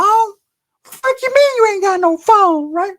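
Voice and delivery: high, slow yell of disbelief; incredulous yell. Retold or imitated. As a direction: shout it in total disbelief. Disbelieving rant at girl without phone.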